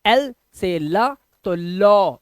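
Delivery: एल से ला तो लॉ said slowly, each sound apart. Shown as a demonstration. Teacher slowly sounding out लॉ for students. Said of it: "इसे लॉ पढ़ो".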